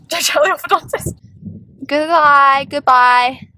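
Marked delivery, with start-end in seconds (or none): 1.9-3.5 loud, playful goodbye; loud farewell. Real, not acted. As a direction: call out goodbye to the braces. Farewell to braces being removed.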